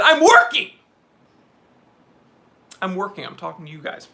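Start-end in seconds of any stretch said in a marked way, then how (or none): 0.0-0.7 high, loud mock complaint; mock outraged yell. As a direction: yell it in comic protest. Mock protest about having to work.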